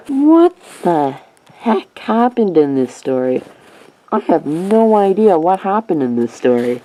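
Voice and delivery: theatrically baffled, each word dragged out; exaggerated bafflement. Real, not acted. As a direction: stretch it out in utter bewilderment. Baffled by the story he reviews.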